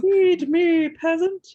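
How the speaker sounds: haughty queen. Pretend. Voicing the dog as queen.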